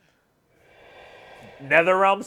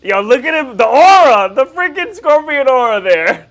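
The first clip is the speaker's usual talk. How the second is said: far higher and much louder, slow and amazed; thrilled yelling; 11 semitones higher, 11 dB louder than usual. Real, not acted. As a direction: lose it over the coolest move in the film. Thrilled reaction to fight scene.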